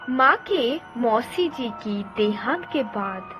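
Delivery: usual speaking voice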